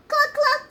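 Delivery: very high, loud cluck cluck; squeaky loud hen sounds; chicken clucking. A narrator's reading. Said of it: "said Chicken".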